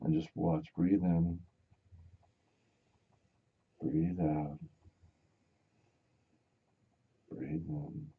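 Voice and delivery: mock guided meditation for drivers and offices. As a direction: talk them down into calm breathing.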